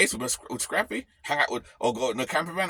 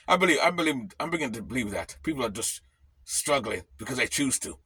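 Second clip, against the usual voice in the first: normal talking voice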